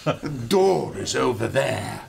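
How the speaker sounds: put-on crisp English-accented line. Corrected to an English woman pointing to the door, English accent. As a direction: redo it as a proper English woman. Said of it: "it wasn't Irish, it was English".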